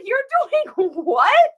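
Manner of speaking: high, loud, drawn-out disbelief with a laugh; mock astonishment. Best friend hears about the lives, high-pitched mock astonishment. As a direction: imitate a friend squealing in amused shock.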